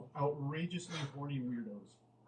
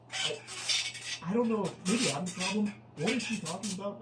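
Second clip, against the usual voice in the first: loud, higher, bewildered questioning; baffled exasperation; 4 semitones higher, 5 dB louder than usual. Real, not acted. Reader reacting to a baffling dating chat, loudly bewildered. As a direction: throw up the confusion loudly.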